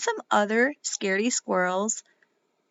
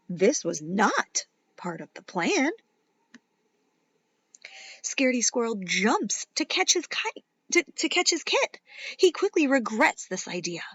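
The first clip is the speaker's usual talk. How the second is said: put-on lively read-aloud for kids; animated children's story reading; story narration; 5 semitones higher than usual. A narrator's reading. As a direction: read it like a bedtime picture book. Reads picture book to children.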